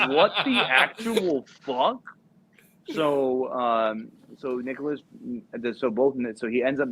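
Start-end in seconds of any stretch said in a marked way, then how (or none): 0.1-2.0 high, slow, drawn-out astonishment; slow, laughing mock outrage; utter disbelief. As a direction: stretch each word in high-pitched disbelief. Acted disbelief, said emphatically.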